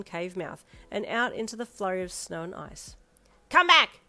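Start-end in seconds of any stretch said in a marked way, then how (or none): 3.5-4.0 high, loud call after them; a wild creature calling after a fleeing traveller; shout. A narrator's reading. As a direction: yell it across the snow. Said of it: "you heard the thing shout".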